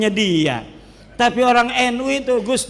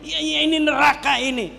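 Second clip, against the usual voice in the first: lampooning a hardliner who condemns everyone, hammed-up shout; mock zealot shouting; 6 semitones higher than usual. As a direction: caricature a fanatic yelling accusations. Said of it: "orang Wahabi ... dia yang menghakimi".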